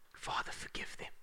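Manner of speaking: a soft, reverent whisper of forgiveness; hushed whisper. Acted. Pastor whispers Jesus's plea to the congregation, hushed. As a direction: breathe the words almost silently.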